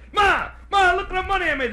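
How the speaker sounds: high, loud calling to Ma; thrilled child's shout; excited kid. Acted. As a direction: burst in showing off to mother. Kid proudly shows mother his earnings.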